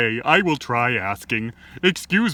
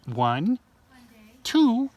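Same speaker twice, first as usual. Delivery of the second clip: building count; 8 semitones higher, much slower than usual. Acted. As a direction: build to the big reveal.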